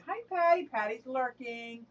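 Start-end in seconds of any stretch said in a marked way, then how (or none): 0.1-0.7 excited greeting. Real, not acted. Greeting viewers in chat.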